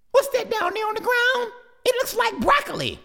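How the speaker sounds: put-on high curious kid voice; high baby hawk voice; puzzled little chick. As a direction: wonder aloud like a child.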